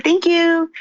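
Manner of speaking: high, eager thank you; bright retold thank you. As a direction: chirp a thrilled thanks. Retold polite reply on phone.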